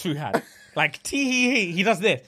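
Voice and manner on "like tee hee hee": imitating a shy tee-hee giggle; high, light mock giggle. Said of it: "when I say Solomon giggles".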